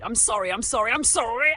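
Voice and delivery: loud, high frantic apology; crying out. A narrator's reading. Magician to the floating family, wailing apology. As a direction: pile up apologies in a panic. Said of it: "Steve cried".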